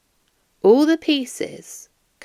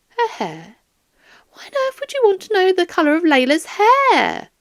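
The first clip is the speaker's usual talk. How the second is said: higher, mildly bewildered grandmother; puzzled old lady; 11 semitones higher, 5 dB louder than usual. Acted. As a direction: ask it like a baffled old woman. Doris puzzled by question.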